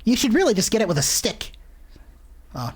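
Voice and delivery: usual speaking voice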